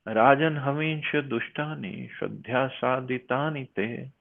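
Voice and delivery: measured, chanted reading of a verse; Sanskrit verse recitation. Acted. Teacher recites the verse to the class, chanting. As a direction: intone the verse like scripture.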